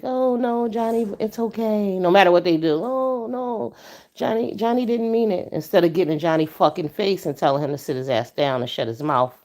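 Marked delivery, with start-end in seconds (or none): 0.0-2.0 mocking a timid, pleading parent, higher and soft. Soft mom excusing a misbehaving kid, mock timid pleading. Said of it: "and you've been like". 2.8-5.5 mocking a timid, pleading parent, higher and soft. Soft mom excusing a misbehaving kid, mock timid pleading. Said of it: "and you've been like".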